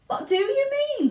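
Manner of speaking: put-on very high, loud puzzled girl; indignant question; Violet. Violet answers her parents, puzzled. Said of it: "said Violet".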